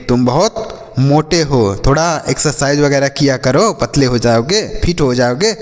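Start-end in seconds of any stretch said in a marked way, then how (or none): none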